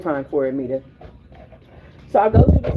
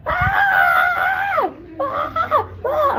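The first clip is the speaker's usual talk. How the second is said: piercing high shrieks, imitating the neighbors; mock panicked screaming; retold screams; much slower than usual. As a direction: shriek like someone screaming at the top of her lungs. Neighbors screaming outside her building.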